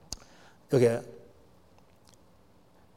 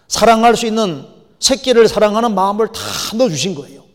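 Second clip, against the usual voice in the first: loud, emphatic sermon delivery; full-force preaching; 7 semitones higher, 9 dB louder than usual. Real, not acted. Preacher urging people to grasp God's love, full-force preaching. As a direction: drive each phrase home from the pulpit.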